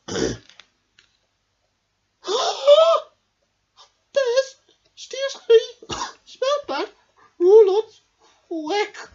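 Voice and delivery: high, fast, pert little bee; clever little bee. Acted. Amelia the clever bee quizzes Norman, high and quick. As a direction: question him like a sharp little know-all.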